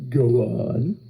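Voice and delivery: low, drawn-out interest; intrigued bargain hunter. Retold or imitated. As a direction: let the curiosity ooze out, low and slow.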